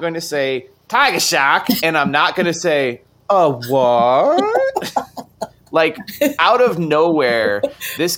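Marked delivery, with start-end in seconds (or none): 0.9-1.7 loud, higher mock Boston accent. Fan quoting a favourite Jaws line, Boston accent. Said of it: "it sounds like a Boston accent". 3.3-4.9 loud, drawn-out, rising sassy disbelief; hammed-up sassy one-liner, stretched upward; sassy movie quote. Fan who cannot resist saying the line, sassy imitation. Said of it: "sassy one-line performance".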